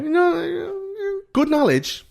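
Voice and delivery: very high, exaggerated fake praise; mock impressed adult. Uncle mocking his nephew's dinosaur lecture in a very high voice. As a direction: gush sarcastically at the know-it-all kid. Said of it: "And you're like".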